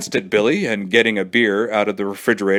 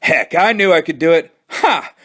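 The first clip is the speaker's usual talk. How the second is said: gloating; 7 semitones higher, 3 dB louder than usual. A narrator's reading. Billy gloating.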